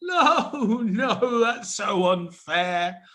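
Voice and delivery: high whiny fake sobbing; hammed-up tantrum, near tears; mock crying. Star upset at losing groom.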